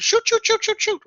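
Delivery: high, loud kiddo chanting a naughty word; imitating little kiddos. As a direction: parrot it like a kid who likes the sound. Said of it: "they like the way it sounds".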